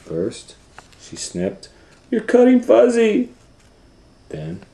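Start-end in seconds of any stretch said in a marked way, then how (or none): 2.1-3.3 very high, loud squeal; alarmed little boy; shocked child. Acted. Owen shocked at the snipping.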